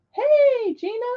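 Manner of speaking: high, bright squeal of hello; excited greeting. Real, not acted. Greeting a chat friend who just joined, excitedly.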